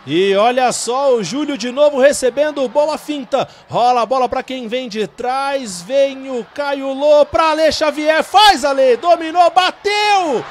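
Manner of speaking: rising excitement as the shot comes; explosive goal commentary. Real, not acted. Goal chance building in commentary. (narração cada vez mais empolgada)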